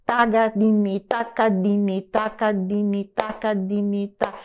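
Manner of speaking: "takadimi" in four equal syllables per beat, level pitch; steady, even run of rhythm syllables; rhythmic syllabification. Shown as a demonstration. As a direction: recite "takadimi" like a metronome. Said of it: "eu tô usando essa sila-, silabação".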